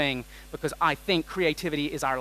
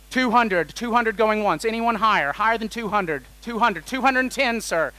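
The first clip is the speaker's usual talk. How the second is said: raised, quick auctioneer patter taking bids; mock auctioneer; 5 semitones higher, 4 dB louder than usual. Speaker auctioning guesses to the audience, mock auctioneer call. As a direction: play auctioneer pushing the bid up, higher.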